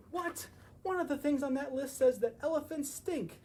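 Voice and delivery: high, loud shocked reaction; surprised. Real, not acted. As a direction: gasp out in disbelief. Reader surprised.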